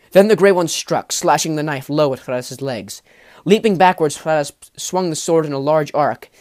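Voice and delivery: loud, urgent fight narration; excited action narration. A narrator's reading. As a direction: drive the action hard.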